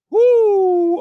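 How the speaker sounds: high hype whoop; excited whoop. Real, not acted. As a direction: whoop for the crowd. Hyped greeting of stream chat.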